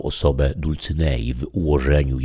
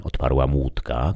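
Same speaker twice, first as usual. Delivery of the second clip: usual speaking voice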